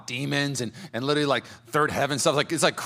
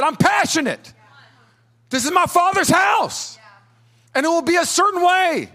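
high, loud impassioned cry; passionate shout; 13 semitones higher, 6 dB louder than usual. Acted. Jesus confronting the church in his Father's house, passionate shout. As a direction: thunder it out with full zeal. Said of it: "Jesus came in and said".

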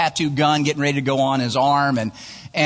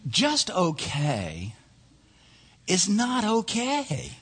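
slow, softer, spaced-out slogan; deliberate refrain; 5 semitones higher, 5 dB quieter, much slower than usual. Real, not acted. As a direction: let each half land slowly and quietly. Preacher lands his refrain.

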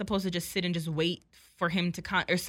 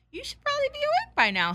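much higher, louder mock-polite nudge; high-pitched hinting; 6 dB louder than usual. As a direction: pitch it way up, acting out your impatient hint. Acted quote, impatient wake-up hint.

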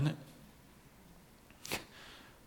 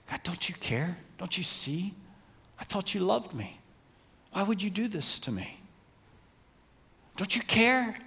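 higher, hurt plea to God; pleading complaint; 6 semitones higher, 3 dB quieter than usual. Acted. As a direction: plead upward, as if let down. Voices the doubting heart's plea.

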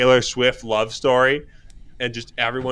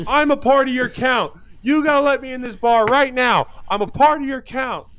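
high-pitched, whiny mock yelling; hammed-up tantrum at a bouncer; 12 semitones higher than usual. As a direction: imitate a furious guy yelling, voice pitched way up. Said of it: "throws an absolute tantrum".

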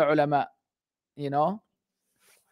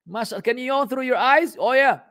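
mocking; 7 semitones higher than usual. Real, not acted. Mocking the son's nose yawn.

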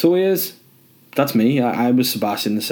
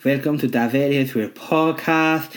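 higher, slow put-on Spanish accent; 5 semitones higher than usual. Host greeting listeners in a mock Spanish accent. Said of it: "That's not the way I speak".

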